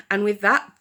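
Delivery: usual speaking voice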